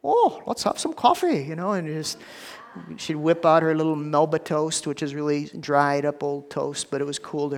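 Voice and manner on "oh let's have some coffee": high-pitched Swedish grandma impression; thick Swedish accent. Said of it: "she had the thickest accent".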